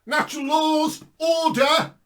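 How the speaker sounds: high, loud, fiery preaching; preaching at full force. Acted. Preacher urging passersby to read the Bible, fiery and loud. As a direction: urge them on at the top of your voice.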